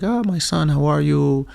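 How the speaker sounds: high, delighted fatherly greeting; lightly voiced proud father; dad's voice. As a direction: play the happy dad answering. Voicing his dad on phone.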